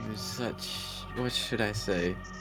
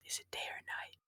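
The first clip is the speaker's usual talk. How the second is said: whispered question to the table; whispered aside; 12 dB quieter than usual. Real, not acted. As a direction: drop to a whisper. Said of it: "whispered aside to GM".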